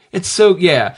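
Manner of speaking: ordinary talk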